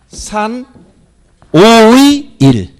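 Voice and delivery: "산 오의 일" slow, with "오의" loud; teacher demonstrating "산 오의 일" word by word; model reading. Shown as a demonstration. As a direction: draw out "오의" loudly, dash read as 의. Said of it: "이렇게 읽어야 돼".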